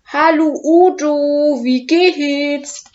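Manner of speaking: loud, high, slow greeting shouted across; calling out. Acted. Little dragon Oskar calls out loudly to Udo at the pit. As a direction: call out cheerfully to the dragon by the pit. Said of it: "ruft er".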